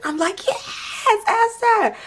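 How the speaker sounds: very high, slow squeal; imitating Esther cheering. Retelling Esther cheering herself on, high squealing. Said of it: "She's going, she's like".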